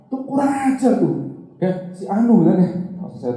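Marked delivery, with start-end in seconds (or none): 0.1-1.1 high, slow indignant scolding; angry outburst. Retold or imitated. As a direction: spit it out like an offended old man. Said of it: "Om Bob langsung masuk tuh marah-marah".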